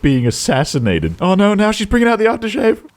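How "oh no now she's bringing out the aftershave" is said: high-pitched dread; mock alarm. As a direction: wail in mock horror at what's coming.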